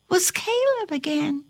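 usual speaking voice

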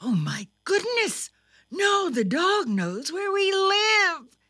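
high-pitched dismay; aghast, voice lifted high; mother's alarmed cry. A narrator's reading. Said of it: "cried Thumper's mother".

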